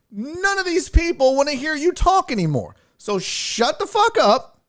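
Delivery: high-pitched exasperated telling-off; fed-up scolding. Retold or imitated. Comedian orders heckler out of the show, exasperated high scolding. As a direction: lay into him, pitch riding high.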